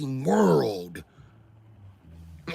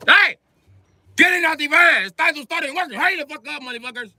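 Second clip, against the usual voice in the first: high-pitched holler to the work crew; foreman yelling; 10 semitones higher than usual. Acted. Foreman rousts cousins from the van, high impatient yell. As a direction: call out sharply, pitched way up, hurrying them.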